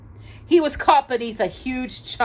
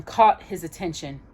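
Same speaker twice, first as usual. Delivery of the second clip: usual speaking voice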